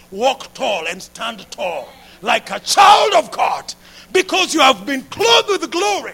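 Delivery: loud, soaring preacher proclamation; impassioned pulpit shout; full-force preaching. Acted. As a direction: preach it out to the congregation. Preaching climax.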